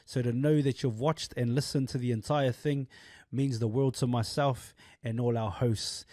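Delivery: soft, even sign-off read; outro read. Acted. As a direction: thank the viewers gently. Outro thanks read to viewers.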